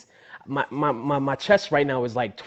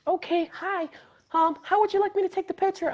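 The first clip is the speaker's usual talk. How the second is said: very high-pitched imitation of a polite helper; photo lady impression. Lady offering to take a fan's picture, imitated high voice. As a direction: put on a high customer-service lady voice. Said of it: "The lady's like".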